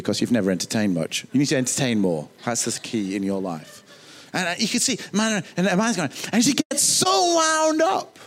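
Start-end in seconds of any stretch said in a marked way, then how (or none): 6.3-8.2 wound up. Retold or imitated. Martha's mounting irritation.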